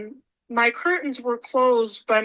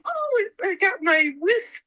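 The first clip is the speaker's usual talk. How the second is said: high, slow happy exclaim; retold joy, pitched up; delighted; 9 semitones higher, slower than usual. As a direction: recall the thrill of a wish come true. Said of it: "I'm like".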